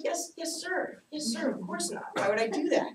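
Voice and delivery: high, soft yes-sir voice; high meek kid promising dad; mock obedient kid. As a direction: sweetly pretend to obey.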